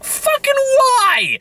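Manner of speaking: very high, stretched-out disbelieving cry; a slow, squeaky outraged question; exasperated why. Acted. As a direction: throw up your hands in disbelief. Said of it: "I'm like".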